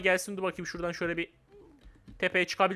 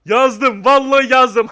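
very loud, jubilant boast; excited, full-voiced exclamation; triumphant shout; 3 semitones higher, 12 dB louder, much slower than usual. Real, not acted. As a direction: shout it out in victory. Triumph at writing the name.

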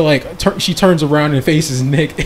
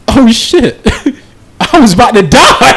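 alarmed; 7 semitones higher, 5 dB louder than usual. Acted. Voices Jean's alarm at near death.

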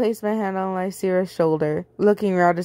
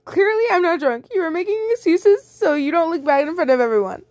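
high whiny accuser; 10 semitones higher than usual. Acted. Sue accusing.

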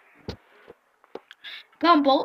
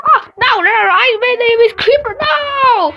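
tearful protest; 5 semitones higher, 6 dB louder than usual. Real, not acted. Crying over Creeper.

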